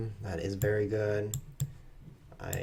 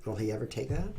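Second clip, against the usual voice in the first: ordinary delivery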